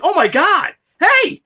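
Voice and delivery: high, loud excited greeting call; excited hey. Retold or imitated. Greeting soldiers back from Iraq, excited high call. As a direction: call out high and thrilled to spot them.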